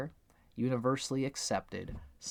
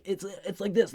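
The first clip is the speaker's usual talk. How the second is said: mock earnest explainer; 8 semitones higher, 4 dB louder than usual. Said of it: "you're like".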